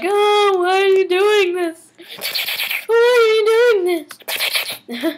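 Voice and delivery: high mock panicked whine. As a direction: ham up his despair. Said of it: "all like".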